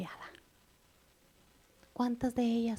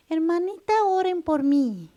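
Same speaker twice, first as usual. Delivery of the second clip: higher, louder, slowed plea for prayer; mock pleading believer; 7 semitones higher, 6 dB louder than usual. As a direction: mimic a lazy churchgoer begging others to pray. Mocking believers begging for prayer. (imitando con burla a una hermana que pide oración)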